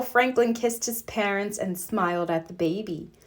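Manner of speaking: plain reading voice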